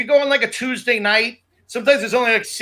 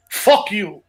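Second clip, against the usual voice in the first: light imitation of a Greek accent. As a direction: curse with a hint of Greek accent. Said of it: "with that Greek accent".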